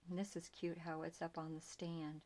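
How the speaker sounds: usual speaking voice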